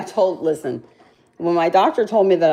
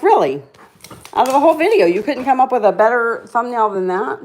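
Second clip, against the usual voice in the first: mock disbelief; 6 semitones higher than usual. Scolding herself over the thumbnail.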